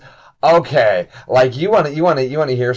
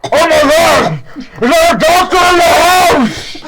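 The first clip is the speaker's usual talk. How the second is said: high, loud cry for help; panicked bugbear shout; 6 dB louder, slower than usual. Acted. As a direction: call out to the whole house, drawn out. Bugbear calls for a doctor.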